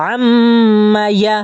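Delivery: "আম্মা ইয়া" with a long hummed m, then short vowels; chanted nasal hold in "আম্মা"; ghunnah nasal hold. Shown as a demonstration. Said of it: "গুণনা, ঠিক আছে?".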